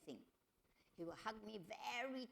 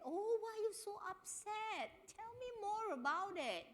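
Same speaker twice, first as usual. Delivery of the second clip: high, gentle consoling question; sympathetic soothing; 12 semitones higher than usual. Shown as a demonstration. Husband hugging upset wife, high gentle sympathy. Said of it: "Give her a hug and say".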